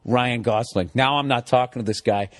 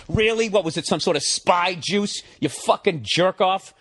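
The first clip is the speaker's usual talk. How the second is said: sarcastic outburst; 6 semitones higher than usual. Retold or imitated. Mocking retort to seatmate.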